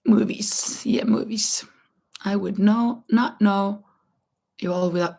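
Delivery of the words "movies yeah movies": whispering. Real, not acted. Repeating a word softly.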